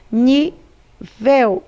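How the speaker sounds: usual speaking voice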